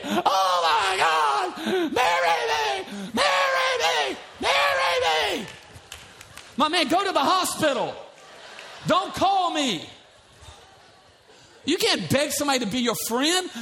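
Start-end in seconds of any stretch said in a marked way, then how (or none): 0.0-5.5 high shouted wailing plea; mock desperate begging. Pastor mocking a man begging someone to marry him. 6.6-9.9 mock shouted refusal. Pastor mocking the rejecting partner, shouted.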